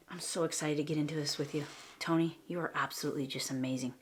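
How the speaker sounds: normal talking voice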